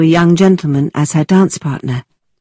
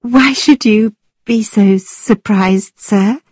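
put-on slow, stagy young lady; theatrical actress; 5 semitones higher, slower than usual. A narrator's reading. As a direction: play it like a stage actress. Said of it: "She too was now speaking like an actor".

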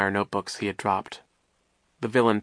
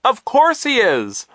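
high, loud, drawn-out cry; high-pitched shouted retort; exasperated cry; 9 dB louder, slower than usual. A narrator's reading. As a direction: call it out slowly at full voice. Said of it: "Violet cried".

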